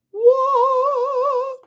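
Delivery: long, very high wavering "whoo"; high playful whoo. Pretend. Joking about the lights going out, a long high whoo. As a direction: hoot one drawn-out note, high and loud.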